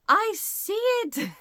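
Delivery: high, slow, knowing voice; drawn-out high sly reply, as if in on the joke; friend Johnny. Retold or imitated. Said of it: "and he's like".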